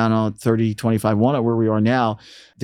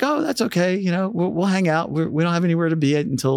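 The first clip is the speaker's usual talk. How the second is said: lightly imitated easygoing star, pitched high; higher-pitched retold voice, breezily accommodating; 7 semitones higher than usual. Visiting star reassures radio staff, lightly voiced good mood. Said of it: "in a really good mood".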